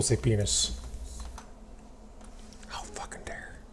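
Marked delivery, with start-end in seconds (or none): 2.7-3.6 a mock outraged whisper; hushed fake indignation. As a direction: hiss the outrage under your breath.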